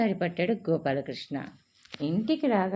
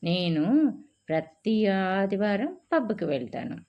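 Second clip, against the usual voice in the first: ordinary delivery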